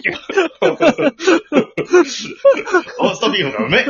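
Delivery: loud, high comic exclamation. Acted. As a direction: blurt it out loudly with a laugh in the voice. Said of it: "大きい声で言って". (甲高い大声でおどけて叫ぶ)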